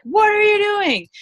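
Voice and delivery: high, loud mock yell; harsh boss shout. Firm supervisor yelling at staff over a mistake. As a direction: snap at them like the intense boss. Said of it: "or like".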